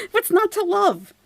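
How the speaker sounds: high, laughing sarcastic question; laughing squeal. Acted.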